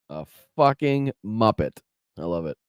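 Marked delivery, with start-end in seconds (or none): none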